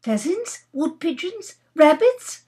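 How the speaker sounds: high, tentative questions; hazarding guesses. Acted. Nora guessing at the bag's contents, hazarding. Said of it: "hazarded Nora".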